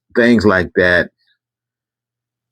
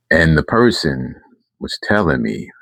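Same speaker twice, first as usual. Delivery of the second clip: ordinary talk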